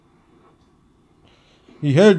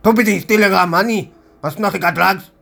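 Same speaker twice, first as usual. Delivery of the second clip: higher, fast, agitated retold demand; 6 semitones higher than usual. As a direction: bark the questions, hunting for her. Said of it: "he demanded".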